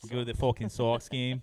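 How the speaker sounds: Sox with a broad Boston vowel, fucking stretched; a quick Boston accent demo. Shown as a demonstration. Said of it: "or saying socks".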